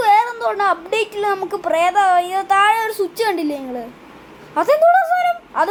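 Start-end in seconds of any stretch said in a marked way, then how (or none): 4.6-5.4 much higher, louder surprised cry; startled question. Real, not acted. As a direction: jump at the sudden sight. Sudden creature appears. (പെട്ടെന്ന് ഞെട്ടി ഉയർന്ന ശബ്ദത്തിൽ ചോദിക്കുന്നു)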